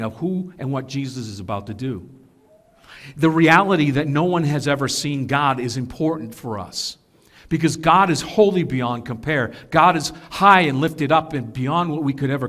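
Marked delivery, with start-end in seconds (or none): none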